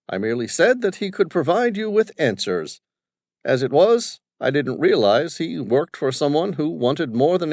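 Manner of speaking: put-on high, loud, bossy old businessman; domineering father. Father questioning his son's crew, bossy and loud. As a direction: voice the overbearing father barking at his staff.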